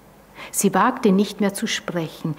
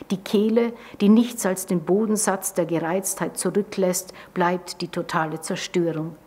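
plain reading voice

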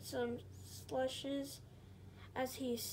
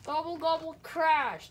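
loud refrain; 4 semitones higher, 8 dB louder than usual. A narrator's reading. Refrain read with gusto.

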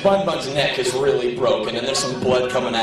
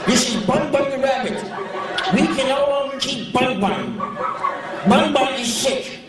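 crazy lady voice; 12 semitones higher than usual. Retold or imitated. Comic mimicking the crazy woman at the pet shop. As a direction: mimic the nutty rabbit owner sweetly. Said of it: "this woman talked like this".

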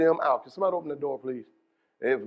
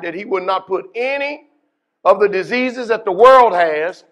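normal talking voice

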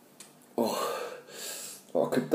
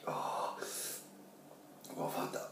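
breathless whisper; 23 dB quieter than usual. Real, not acted. After chili, breathless.

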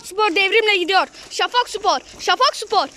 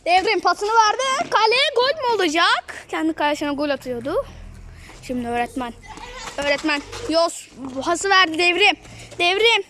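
ordinary talk

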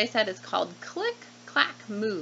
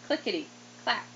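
plain reading voice

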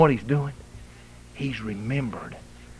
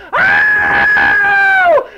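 eagle cry; 15 dB louder than usual. Acted.

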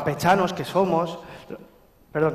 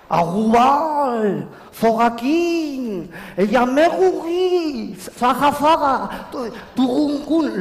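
drawn-out, high French caricature with guttural R; mock French R; 5 semitones higher, much slower than usual. Mocking the French who can't pronounce the R, exaggerated French accent. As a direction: stretch the words slowly in exaggerated French R's. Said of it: "no saben pronunciar la R".

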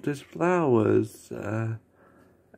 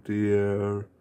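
usual speaking voice

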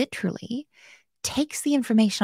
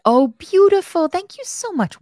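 delighted; 9 semitones higher, 7 dB louder than usual. Real, not acted. Delighted by viewer's help.

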